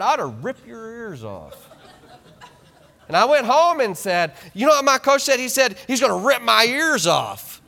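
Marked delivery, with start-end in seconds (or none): 0.0-1.7 a hint of a gruff coach, half laughing; imitating coach. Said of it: "he turned around and said". 4.5-7.6 put-on high, louder, eager kid retelling; excited kid. Boy telling parent coach's threat.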